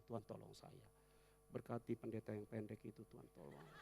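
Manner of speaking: soft, low murmured plea; quiet prayer. Shown as a demonstration. Congregant praying quietly during the sermon, soft. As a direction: speak straight to God under your breath. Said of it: "Engkau bicara langsung sama Tuhan".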